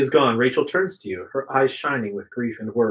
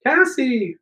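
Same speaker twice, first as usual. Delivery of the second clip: high-pitched cry to a friend; screamed name; 12 semitones higher than usual. A narrator's reading. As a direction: scream it high, without much volume. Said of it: "Rachel screams".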